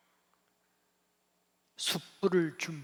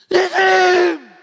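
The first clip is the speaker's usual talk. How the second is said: very loud, high shout; long, high-pitched holler; crying out in recognition; 20 dB louder, much slower than usual. Acted. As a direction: call out at full voice. Peter recognizing Jesus, calling out.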